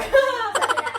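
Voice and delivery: high laugh breaking out; burst of laughter. Real, not acted. Laughs at child's word.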